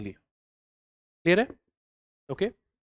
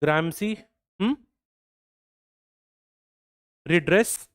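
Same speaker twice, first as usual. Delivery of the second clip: slow dictation; slower than usual. Shown as a demonstration. Teacher dictating a quote.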